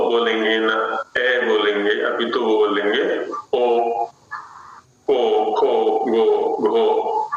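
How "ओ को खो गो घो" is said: को खो गो घो said with a rounded o vowel; ओ को खो गो घो, each syllable ending in o; Bengali o vowel. Shown as a demonstration. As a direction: demo ओ को खो गो घो, the Odia-Bengali way. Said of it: "ओ जैसा उच्चारण बोलेंगे वो".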